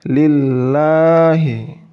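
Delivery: lillahi, slow, the lam thin and light; light Quran reading of lillahi. Shown as a demonstration. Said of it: "baca lillahi".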